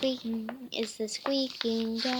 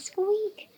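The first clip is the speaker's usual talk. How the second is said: a high, louder squeak called out like a little dog; squeaky cry; 9 semitones higher, 4 dB louder than usual. Pretend. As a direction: pitch it up and squeak out loud. Whispered squeak, then loud squeak.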